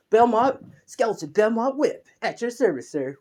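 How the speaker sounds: high, loud announcing servant voice, pitched well up; proud skeleton minion; eager skeleton servant. Acted. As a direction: puff up and present yourself to the new master, loud and high. Skeleton servant introduces himself.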